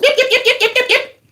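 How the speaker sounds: high squeaky yapping, laughing through it; little dog yipping. Retold or imitated. Mocking a small dog yapping at Zelensky, high and laughing. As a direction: yip like a tiny dog. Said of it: "the little dog that's going".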